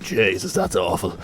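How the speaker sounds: lightly put-on Dublin accent. Dubliners calling in to complain about the actor's accent, light mock Dublin. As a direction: imitate a disapproving Dubliner. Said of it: "people from Dublin will come in and be like".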